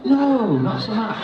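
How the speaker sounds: high, loud mock refusal; imitated English teenage girls. Teenage fans rejecting his American accent, high imitated girlish voice. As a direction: mimic girls turning him down in a high voice. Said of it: "all the teenage girls go".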